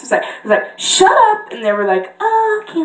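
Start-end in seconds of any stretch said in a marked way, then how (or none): 0.8-1.5 high mock outburst; random character voice. Retelling a live stream, high mock telling-off. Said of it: "talking in so many random characters' voices". 2.2-2.8 high cartoon reply; random character voice. Viewers answering being hushed, high mock voice. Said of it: "talking in so many random characters' voices".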